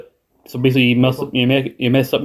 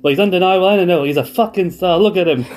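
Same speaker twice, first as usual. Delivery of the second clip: fast, higher mock New Zealand accent; 6 semitones higher than usual. Imitating a wrestler hyping up the champion in a New Zealand accent. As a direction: rattle it off hyped in a put-on New Zealand accent. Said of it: "I think I'm sounding more Australian than New Zealand".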